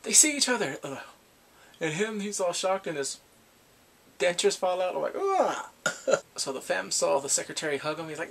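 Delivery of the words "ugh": high, loud noise of disgust; grossed-out "ugh". Retold or imitated. Recapper reacting to fallen dentures, mock disgusted groan.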